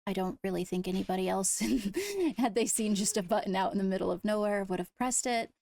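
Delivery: ordinary talk, nothing marked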